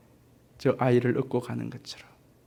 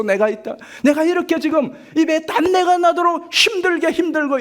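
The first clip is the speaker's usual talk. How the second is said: exasperated complaint; 11 semitones higher, 3 dB louder than usual. Acted. Believer complains to God.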